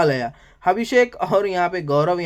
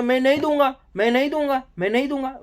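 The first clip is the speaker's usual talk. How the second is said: mock stubborn refusal; 7 semitones higher than usual. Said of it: "बोलता".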